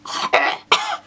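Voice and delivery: airless high choking hack; sudden choking fit; choking cough. Real, not acted. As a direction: cough like something's stuck in your throat. Choking on inhaled fly.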